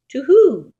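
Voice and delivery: high, loud hooting owl. Acted. Owl calls down to the Gruffalo's child, high hoot.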